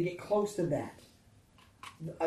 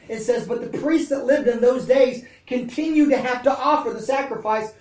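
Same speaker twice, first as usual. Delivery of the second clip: loud, higher, driving sermon voice; preaching at full force; 3 semitones higher, 7 dB louder than usual. Real, not acted. Preacher assuring the congregation that God says don't be afraid, at full force. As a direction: preach it out with rising fervor.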